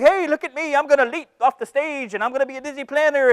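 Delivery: mocking imitation. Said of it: "You're like".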